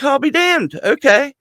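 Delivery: high-pitched, impressed reaction; retold pleased surprise. Doubter reacting to No Ring wrestling, pleasantly surprised. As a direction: pitch jumps way up, acting out being won over. Won over after watching, retold.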